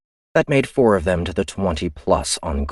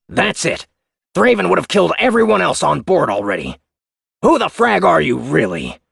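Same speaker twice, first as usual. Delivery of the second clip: higher, louder hostile reply; defiant crewman; 11 semitones higher, 5 dB louder than usual. Acted. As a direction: challenge her openly. Otero voice.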